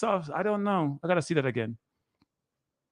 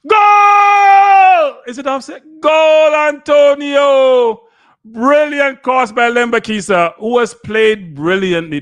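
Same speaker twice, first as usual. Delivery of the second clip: ecstatic goal shout, much higher and louder; goal celebration; 13 semitones higher, 13 dB louder, much slower than usual. Real, not acted. Fan commentator erupting at the Jamaica goal, ecstatic shout. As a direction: scream the goal call with everything.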